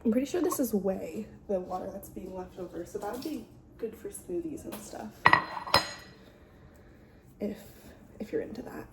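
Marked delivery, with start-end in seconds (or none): 3.1-8.8 whispering. Real, not acted. Quiet aside about whey.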